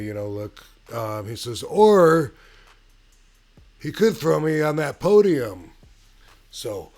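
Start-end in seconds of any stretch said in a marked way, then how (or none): 1.6-5.7 a burst of ring-announcer hype, loud and high; hyped wrestling call. Retold or imitated. Wrestler [name] hypes the crowd, loud mock promo. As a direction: sell the big finish like a promo. Wrestler voicing his own finish.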